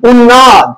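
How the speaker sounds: উন্নদ said with a doubled n and no m; expected pronunciation. Shown as a demonstration. Teacher showing students the form the rule would give. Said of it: "হওয়ার কথা ছিল কি".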